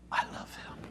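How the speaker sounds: breathy whispered confession; hushed awe. Real, not acted. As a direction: whisper it like a secret prayer. Awed confession of love.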